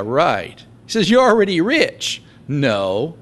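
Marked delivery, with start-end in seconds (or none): 1.1-2.2 high mocking praise; sarcastic. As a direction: pretend to admire them, dripping sarcasm. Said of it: "And Paul gets sarcastic".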